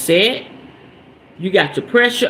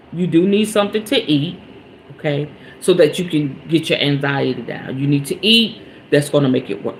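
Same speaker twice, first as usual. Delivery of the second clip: soft, lower, soothing yourself down; calm self-talk; 6 semitones lower, 3 dB quieter than usual. Retold or imitated. To herself in the drive-through, calm soothing voice. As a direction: talk yourself out of the anger, gently. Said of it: "I should have done this".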